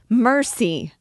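loud, high cry for mercy; terrified shout; frantic plea. A narrator's reading. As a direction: beg at the top of your voice. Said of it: "she cried frantically".

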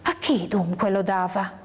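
ordinary delivery

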